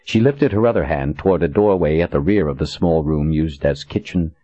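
ordinary delivery, nothing marked

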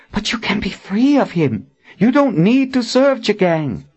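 high, insistent persuading; urgent appeal. A narrator's reading. Verna urges her captors to leave Jagang, urgent appeal. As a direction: urge them to break free.

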